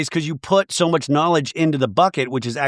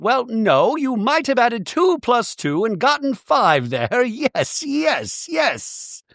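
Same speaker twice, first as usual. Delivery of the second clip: much higher Bronwyn, tittering yes yes; high Bronwyn impression; 9 semitones higher, slower than usual. Mocking Bronwyn brushing off Heather, pitched high with a titter. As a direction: giggle it off up high. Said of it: "But Bronwyn's like".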